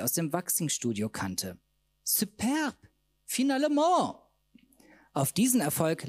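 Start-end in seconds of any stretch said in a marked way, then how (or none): 2.0-2.8 high delighted exclamation; triumphant high cry; excited cry. Acted. 3.2-4.2 high, loud French exclamation; high triumphant shout; triumphant cry. Acted.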